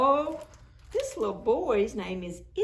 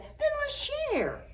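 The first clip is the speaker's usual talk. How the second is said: put-on very high small boy voice; little boy voice. A narrator's reading. Little boy offers the snowman his scarf, very high. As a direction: sound like a small generous boy.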